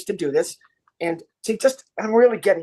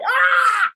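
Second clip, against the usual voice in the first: very high, loud hammed-up scream; mock scream; 5 dB louder than usual. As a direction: let out a big fake scream. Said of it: "scream ending the scene".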